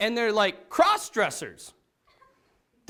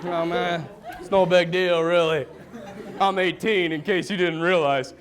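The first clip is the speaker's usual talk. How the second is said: put-on cocky teen; 5 semitones lower than usual. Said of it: "Just an idiot".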